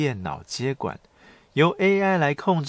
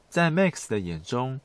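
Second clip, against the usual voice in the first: normal talking voice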